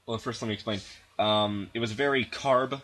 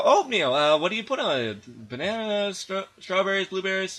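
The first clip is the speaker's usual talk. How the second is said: higher, bright fake-cheery questions; overly polite small talk; 8 semitones higher, 3 dB louder than usual. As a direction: put on a polite eager voice. Acting out her own polite chat.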